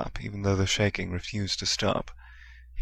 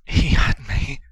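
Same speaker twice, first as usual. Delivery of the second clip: breathless, louder panicked gasp; 6 semitones higher, 8 dB louder, slower than usual. A narrator's reading. Harry tells the teachers, gasping in fear. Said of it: "Harry gasped".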